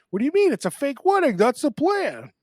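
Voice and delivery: high, loud fake New Jersey accent; hammed-up New Jersey accent. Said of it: "I just go into fake New Jersey accent there".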